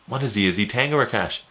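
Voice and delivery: ordinary talk, nothing marked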